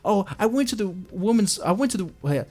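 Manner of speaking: high mincing put-on voice; mock a creepy complaint. Mocking Yaniv's gym post in a high voice. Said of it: "going on saying".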